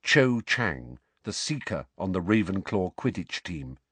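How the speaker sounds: usual speaking voice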